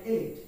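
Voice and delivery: ইলিট as i-LEET; correct pronunciation. Shown as a demonstration. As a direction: say ইলিট with an ee vowel. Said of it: "এর উচ্চারণ হবে".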